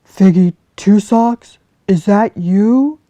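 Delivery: loud, slow hopeful call. Acted. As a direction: call into the hollow tree. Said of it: "he called".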